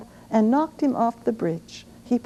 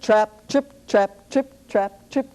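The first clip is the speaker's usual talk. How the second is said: ordinary delivery, nothing marked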